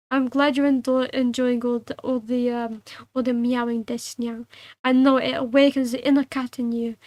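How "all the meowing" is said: plain reading voice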